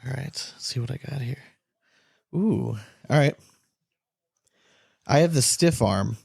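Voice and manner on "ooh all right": usual speaking voice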